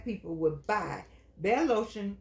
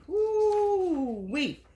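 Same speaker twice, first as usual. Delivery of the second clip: whoo-wee exclamation; 10 semitones higher, 4 dB louder than usual. Real, not acted. Exclaiming about Willie Lynch.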